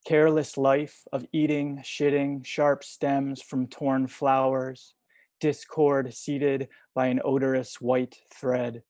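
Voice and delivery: measured poem recital with pauses between lines; poetry reading. Acted. Poet reading short poems to an online audience, measured recital. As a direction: read each line deliberately, leaving space after it.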